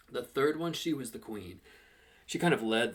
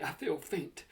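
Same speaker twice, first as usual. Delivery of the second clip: higher, hammed-up faintness; mock swoon; 6 semitones higher, slower than usual. A narrator's reading. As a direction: pretend to go weak with admiration. Stokesy mock-swooning over the girls.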